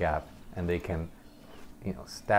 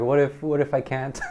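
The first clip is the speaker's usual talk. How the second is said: imitating scared patient; 7 semitones higher, 4 dB louder than usual. Patient panicking during spinal tap.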